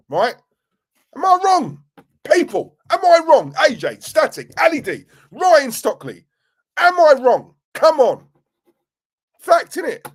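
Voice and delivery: loud, high-pitched, worked-up challenge; getting excited. Real, not acted. Ranting fan demanding agreement from the viewers, really excited. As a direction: fire questions at the viewers, pitch soaring. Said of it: "I'm getting excited".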